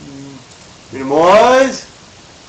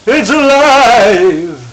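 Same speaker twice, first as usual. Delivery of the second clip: mad scientist; 5 semitones higher, 4 dB louder, much slower than usual. Pretend. Podcast going live, Frankenstein quote.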